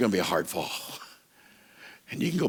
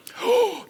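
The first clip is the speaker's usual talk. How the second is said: sudden high sharp intake of breath; dramatic gasp; 4 dB louder than usual. Pretend. Preacher builds suspense before the roaring lion, gasp. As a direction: gasp like you just saw the lion.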